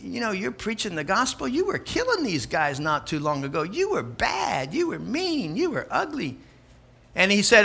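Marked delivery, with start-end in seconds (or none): 0.0-6.4 quiet, sing-song taunting devil; accusing devil. Acted. Devil reminding Paul of his past, taunting quietly. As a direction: needle him softly with a rising and falling lilt. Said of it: "tried to beat him down and say".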